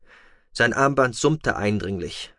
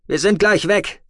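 a lightly raised, brisk voice for the pilot Tech; Tech's voice; 9 semitones higher, 5 dB louder than usual. A narrator's reading. Tech telling the captive noble they are leaving, lightly voiced. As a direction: voice Tech a touch higher and brighter than the narrator.

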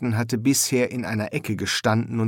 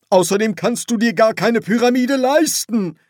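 high, raised voice climbing in protest; indignant rant; 12 semitones higher, 6 dB louder than usual. Acted. Ignored ghost protesting against burial, indignant rant. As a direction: rant in outraged questions, high and loud.

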